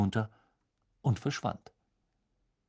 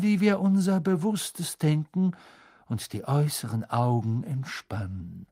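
solemn, higher-voiced fortune teller; mystic seer; 4 semitones higher, 5 dB louder than usual. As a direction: announce fate like an otherworldly seer. Trelawney voiced by narrator.

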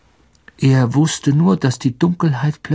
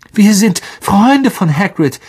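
loud, high, urgent call; 9 semitones higher, 6 dB louder than usual. A narrator's reading. A captive boy pleading with giant spiders, calling out high and loud. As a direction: cry it out across the hollow. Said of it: "rief Harry".

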